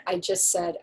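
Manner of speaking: ordinary talk, nothing marked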